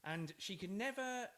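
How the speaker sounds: ordinary delivery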